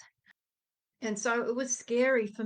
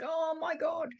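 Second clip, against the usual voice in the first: mock high-pitched panic; a retold gasp of nervous disbelief, pitched up; freaking out; 7 semitones higher than usual. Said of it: "freaking out".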